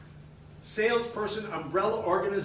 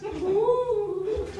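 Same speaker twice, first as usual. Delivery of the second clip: receptionist's squeaky Ooh, much higher; a pitying high Ooh; hammed-up clerk's Ooh; high mock "Ooh"; 5 dB louder than usual. Receptionist's reaction.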